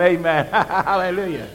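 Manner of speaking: ordinary talk, nothing marked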